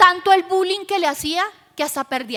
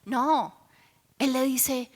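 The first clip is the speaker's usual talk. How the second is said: ordinary delivery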